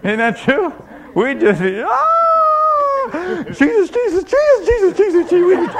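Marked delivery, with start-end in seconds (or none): 1.9-3.4 long, very high scream; hammed-up scream of terror; panicked scream. Preacher acts out terrified Christians seeing the devil, panicked scream. 3.5-5.7 high, loud, repeated cries for help; hammed-up terrified chanting; frantic panic. Preacher mimics frightened Christians calling on Jesus, frantic panic.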